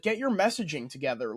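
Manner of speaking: normal talking voice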